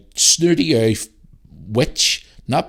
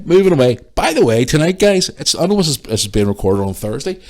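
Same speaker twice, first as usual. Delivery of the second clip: usual speaking voice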